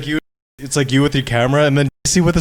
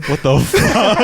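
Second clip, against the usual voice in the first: laughing disbelief; 9 semitones higher, much slower than usual. Retold or imitated. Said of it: "I was just like".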